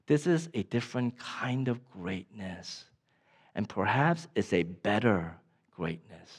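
low, soft, reverent delivery with long pauses; hushed preaching. Real, not acted. Preacher telling the church God is humble, hushed. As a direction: let each phrase settle, quiet and low. Hushed, slow sermon climax.

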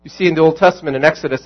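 ordinary delivery

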